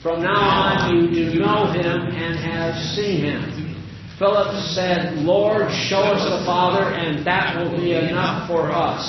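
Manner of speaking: solemn Bible reading; scripture reading. Acted. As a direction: read the gospel to the congregation. Reads scripture aloud.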